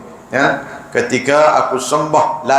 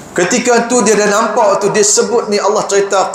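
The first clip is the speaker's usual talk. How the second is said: impassioned preaching; 9 semitones higher than usual. Acted. Preacher rises to the climax for the congregation, fervent. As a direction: build to the climax with fervour.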